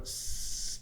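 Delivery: "S-" hissed with no voice; a long voiceless "S-", no buzz in the throat; isolated hissed "S-"; unvoiced s sound. Shown as a demonstration. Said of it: "Feel your throat".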